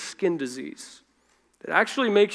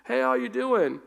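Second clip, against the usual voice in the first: calling down; 6 semitones higher than usual. Retold or imitated. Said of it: "somebody kind of shouting over a cliff".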